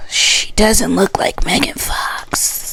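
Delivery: soft, slow unvoiced whisper. Real, not acted. Telling viewers the truth about Chelsea, whispered. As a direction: breathe it out like a secret. Whispered then repeated loud.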